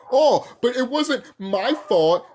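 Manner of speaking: slow, whiny mock excuse; mocking whine. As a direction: drag it out like a lame excuse.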